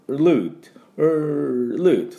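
ルート starting "er" then slipping into "loot"; Lになる失敗. Retold or imitated. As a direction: imitate learners whose R in ルート turns to L. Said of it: "Lになってる人が、". (ルート を「er」の後にLで言ってしまう真似)

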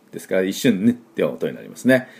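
ordinary talk

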